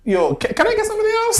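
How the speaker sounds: high, hurried customer voice through laughter; mimicked fed-up customer. Drive-through customer wants another worker, mimicked high and quick. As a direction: rush it out high, amused at the memory.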